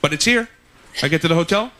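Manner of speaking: usual speaking voice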